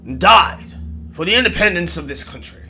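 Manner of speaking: put-on loud, indignant old English colonel; old English accent. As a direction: rail in old English, high and forceful. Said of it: "in his old English accent".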